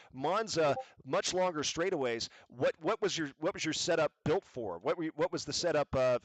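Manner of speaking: ordinary talk, nothing marked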